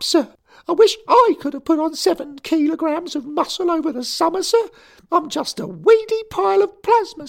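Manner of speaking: a furious choirboy insisting he's grown; angry schoolboy. A narrator's reading. Derek protesting to his boss, high indignant boy.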